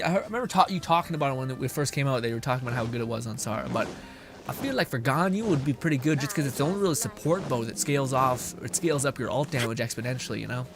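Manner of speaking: ordinary talk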